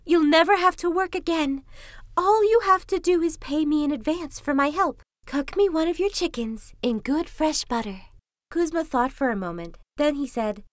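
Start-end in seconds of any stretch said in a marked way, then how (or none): 0.0-8.0 high-pitched fox, persuasive; bargaining fox. A narrator's reading. Trapped fox bargaining with Kuzma, high and persuasive. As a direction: pitch it high and coax the captor.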